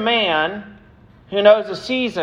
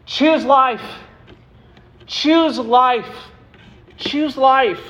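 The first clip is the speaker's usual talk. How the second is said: higher, insistent repeated call; urgent plea; 6 semitones higher, slower than usual. Real, not acted. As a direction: urge them to choose life. Preacher's closing exhortation.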